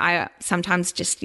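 ordinary delivery

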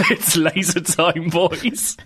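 slow repeat through laughter; amused, drawn-out mock slogan; laughing catchphrase. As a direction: giggle out the cartoon slogan.

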